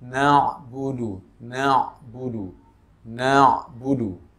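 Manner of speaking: na'budu with the ain between stopped and flowing; slow na'budu, ain half held; slow careful na'budu, ain neither cut nor overdrawn; balanced ain. Shown as a demonstration. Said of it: "Tapi tengah-tengah".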